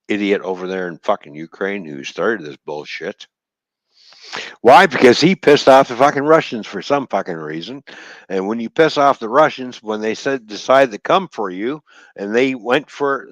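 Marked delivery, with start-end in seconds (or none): 4.6-13.3 raised, louder angry rant about the Russians. Real, not acted. Ranting at viewers about Ukraine, fuming higher and louder.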